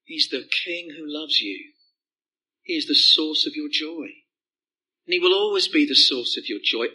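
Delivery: ordinary talk, nothing marked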